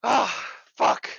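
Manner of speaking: heavy exhaled groan of real frustration; exasperated groan. Real, not acted. Listener venting to the hosts, exasperated groan. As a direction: groan out the curse, worn out. Venting after a dreadful session.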